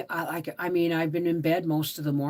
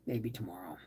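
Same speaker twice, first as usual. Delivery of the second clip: whisper; 10 semitones lower, 13 dB quieter, faster than usual. Real, not acted. Whispered aside to herself.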